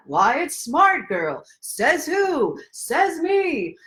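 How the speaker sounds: hammed-up says me; playful hero banter. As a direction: answer yourself like a cheeky cartoon hero. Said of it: "shtick for that one".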